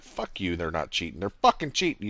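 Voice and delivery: ordinary talk, nothing marked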